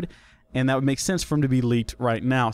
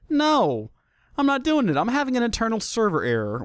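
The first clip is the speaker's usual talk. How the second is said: petulant Google; 8 semitones higher than usual. Acted. Google refusing to translate for the host, petulant. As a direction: play a stubborn website digging its heels in. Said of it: "Google's just like".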